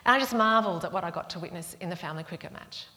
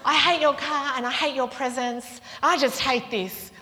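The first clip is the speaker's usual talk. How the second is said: loud, high mock tantrum; hammed-up small child's rage; angry child; 7 semitones higher, 9 dB louder than usual. Four-year-old rejecting the aunt's presents, angry. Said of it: "Like, just angry".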